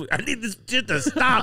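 high, desperate mock wail; acted crying plea. Too-high stoner begging it to stop, acted wail. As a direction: plead as if about to cry. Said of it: "I cried".

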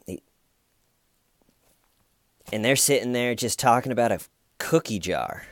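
usual speaking voice

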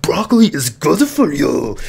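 lightly voiced nagging parent, slow; a hint of a parent pushing food on a kid; mock parent. Parents telling a child to eat broccoli, mildly mock nagging. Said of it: "their parents were like".

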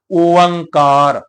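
ਓੰਕਾਰ drawn out very slowly as "oo-ang-kaar", falling at the end; slow demonstration. Shown as a demonstration. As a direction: stretch ਓੰਕਾਰ long and slow to show its sound. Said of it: "ਅਸੀਂ ਸਾਰੇ ਹੀ ਇਹਦਾ ਉਚਾਰਨ ਕਰਦੇ ਹਾਂ". (ਓੰਕਾਰ ਨੂੰ ਬਹੁਤ ਹੌਲੀ ਖਿੱਚ ਕੇ ਬੋਲੋ)

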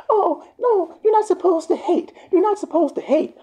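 very high, loud mock protest; exaggerated high-pitched objection; mocking objectors. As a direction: imitate scolding critics way up high. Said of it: "Y'all be like".

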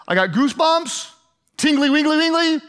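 playfully mock a giddy churchgoer; playful mock answer.

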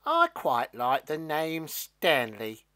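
drawn-out, emphatic character line; beanstalk character, pondering. A narrator's reading. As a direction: announce it slowly and deliberately as the beanstalk. Stalk answers after thinking.

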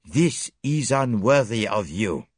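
slow, firm reproach; solemn, emphatic admonishment; grave. A narrator's reading. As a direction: rebuke gravely, each word weighted. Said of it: "said Poirot gravely".

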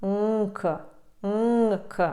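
"нк" as nasal NG released into K; hum, then click off a K in "нк"; nasal plus K. Shown as a demonstration. Teacher showing learners the NK sound, nasal plus K. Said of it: "носовий звук н з призвуком к".

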